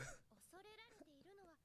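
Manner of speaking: high wheezing laugh close to tears; crying laughter. Real, not acted. Watching him mess it up, a crying laugh. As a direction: laugh until it almost breaks into crying.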